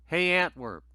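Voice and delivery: ordinary talk, nothing marked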